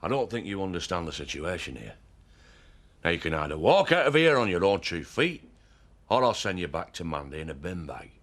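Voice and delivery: low, controlled threat; menacing, pitched well down. Acted. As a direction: warn him off in a low voice. Threatens the unwanted visitor.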